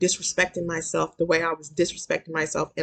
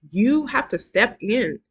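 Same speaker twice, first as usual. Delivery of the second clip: ordinary talk, nothing marked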